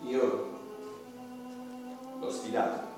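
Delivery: ordinary delivery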